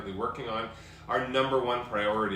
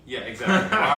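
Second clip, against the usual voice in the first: much louder, high exclamation; loud excited agreement; 4 semitones higher, 11 dB louder than usual. Real, not acted. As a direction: blurt it out delightedly. Agreeing with joke.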